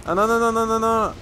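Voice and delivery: high panicked string of no's; panicking as enemies swarm. Real, not acted. Panicking under attack.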